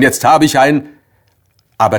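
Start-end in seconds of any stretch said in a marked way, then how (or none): 0.0-0.9 higher, louder excited announcement; eager call. Acted. Narrator eagerly announces his word, higher and louder. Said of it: "habe ich gerufen".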